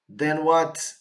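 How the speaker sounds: normal talking voice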